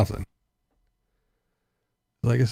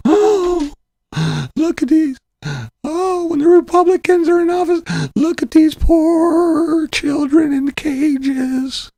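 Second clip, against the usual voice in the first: much higher, slow, whining mock concern; mocking whiny voice; slower than usual. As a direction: squeak it out like a fake-caring politician. Mocking Democrats' fake concern.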